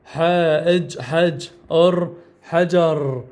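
حاء إج حج then أر حجر, spelled slowly; slow syllable blending. Shown as a demonstration. Teacher modelling the right way, slow and clear. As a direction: sound out each syllable slowly, then the whole word. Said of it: "لا".

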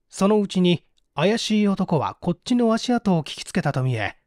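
plain reading voice

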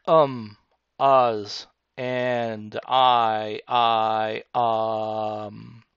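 drawn-out ums and hesitant I, I; filler words. Shown as a demonstration. Instructor showing viewers hesitant filler words. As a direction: hesitate and fill the gaps with um. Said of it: "what we commonly known as filler words".

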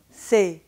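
"c'est" held on one tense e; crisp, unglided "c'est"; French side of the contrast; French pure vowel. Shown as a demonstration. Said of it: "in French".

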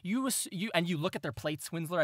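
usual speaking voice

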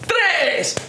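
ordinary talk

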